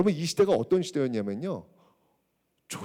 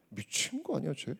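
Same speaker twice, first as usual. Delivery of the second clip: a quiet, scornful aside about a madman; scornful minister; 3 semitones lower, 10 dB quieter than usual. Retold or imitated. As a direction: ministers sneering at Kim Yuk, mocking under breath. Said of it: "모든 신하들이 다 들고 일어나는 거예요".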